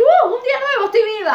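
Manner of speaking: squealing high and loud, bursting with joy; mock cheerful wake-up. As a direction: chirp it out like the happiest morning ever. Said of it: "de decir".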